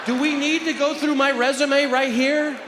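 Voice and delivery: high, loud, drawn-out protest; indignant retort. Acted. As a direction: squawk it slowly, stung by the question. Stung retort to judge.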